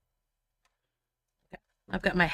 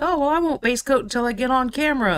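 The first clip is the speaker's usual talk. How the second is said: higher, quick mock-confident voice; breezy self-quote; 7 semitones higher, 4 dB louder, faster than usual. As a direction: imitate your own naive resolve, quick and high. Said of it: "I'm like".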